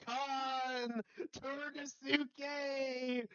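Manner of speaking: high strained plea calling out for rescue; desperate cry. Acted. Tyson crying out to Khan for help, high desperate plea.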